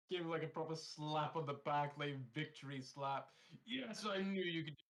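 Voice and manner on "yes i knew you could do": loud, high celebration; proud cheer. Acted. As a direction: slap his back and cheer. Cheering friend after chug.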